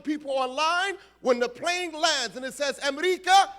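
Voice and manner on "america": mock call. Airport welcome for Americans.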